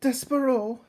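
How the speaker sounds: high, loud cry of her son's name; a mother crying out to her son; calling out. Acted. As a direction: call out to him across the crowd. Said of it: "Antoinette called out".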